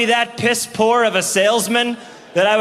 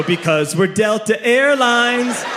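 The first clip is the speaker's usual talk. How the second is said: slow villain declaration; 4 semitones higher, slower than usual. Retold or imitated. Airline villain explains.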